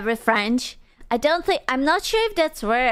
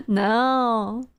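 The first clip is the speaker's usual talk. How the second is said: ordinary talk, nothing marked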